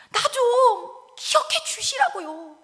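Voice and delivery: high, loud, drawn-out wail; tearful pleading. Acted. Hezekiah weeping to God, wailing plea. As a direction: plead as if sobbing and pounding the ground. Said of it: "땅을 쾅쾅 치면서 울기 시작해요".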